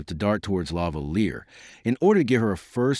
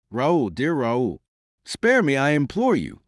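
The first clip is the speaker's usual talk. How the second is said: imploring cry; 6 semitones higher than usual. A narrator's reading. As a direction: beg him to stop, building from pleading to a cry. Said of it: "cried La Valliere".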